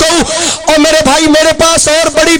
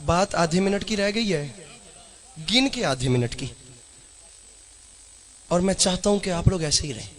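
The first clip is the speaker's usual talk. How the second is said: much softer, lower, intimate delivery; hushed preaching; 6 semitones lower, 18 dB quieter than usual. Real, not acted. Preacher softly asking the crowd to listen to one line. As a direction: draw the crowd in quietly. Quiet restart, pleading for attention.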